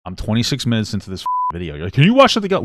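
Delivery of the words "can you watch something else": loud, high-pitched mock quote; mocking a viewer. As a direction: parrot the request back, high and loud. Mimicking an annoying chat viewer.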